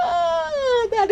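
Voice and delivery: high sighing "oh" after laughing; tickled. Real, not acted. Streamer tickled by the shoelaces, recovering from laughter. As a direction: come down from a laughing fit.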